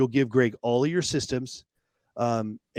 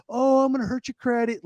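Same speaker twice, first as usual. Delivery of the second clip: much higher, singsong mock threat; 11 semitones higher than usual. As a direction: parody a harmless landlord warning. Mocking a mild lease threat.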